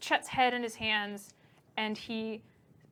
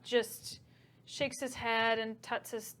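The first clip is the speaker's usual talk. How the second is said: ordinary delivery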